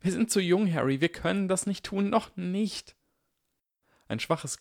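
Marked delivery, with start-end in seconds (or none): 0.0-2.9 put-on high, worried girl's voice; plead, young and scared; anxious Hermine.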